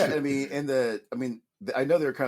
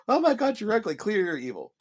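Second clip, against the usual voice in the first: mock shocked gasp; 10 semitones higher, 4 dB louder than usual. Mock reaction to the witch.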